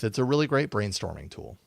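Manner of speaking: ordinary delivery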